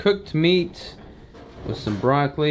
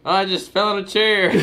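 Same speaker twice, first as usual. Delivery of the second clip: innocent brother voice; 8 semitones higher, 6 dB louder than usual. Retold or imitated. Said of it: "Tyler's like".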